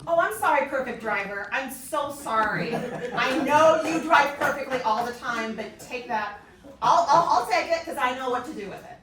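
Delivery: mock the perfect driver loudly; hammed-up sarcastic road rage; sarcastic apology. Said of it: "We're like".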